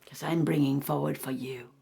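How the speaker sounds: soft, whispery meditation voice; guided meditation. Acted. Teacher invites listeners to feel gratitude: soft meditation voice. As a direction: draw them gently inward.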